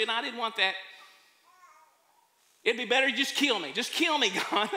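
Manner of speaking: loud, high, fast complaining rant with a laugh; whiny outburst. Acted. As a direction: vent like a sulking prophet who wants to die. Jonah's angry complaint to God.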